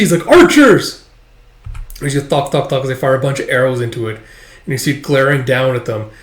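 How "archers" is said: very high, loud single-word order; shouted command. Acted. Commander calls archers to fire on dragon, shouted. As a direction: bellow it to the ranks.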